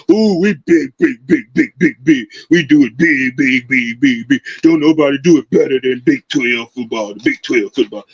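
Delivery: a hint of a cocky fan talking himself up; mock Texas fan. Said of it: "these Texas folks think".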